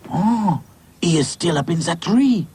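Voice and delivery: put-on high French-accented character voice. French doll answers worried friend.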